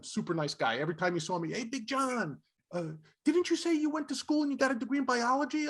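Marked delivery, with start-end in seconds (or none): 1.5-5.7 higher, lightly voiced friendly colleague; imitated eager question. Fez stopping him in the hall, eager imitated question. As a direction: ask it like a nervous buddy.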